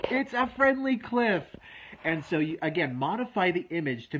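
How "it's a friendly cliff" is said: put-on gasp, then high, amazed child's thought; a kid's sudden happy discovery, pitched high; delighted realization. Recalling his eight-year-old self discovering the cliff is friendly, high and amazed.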